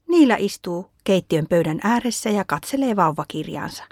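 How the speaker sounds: ordinary delivery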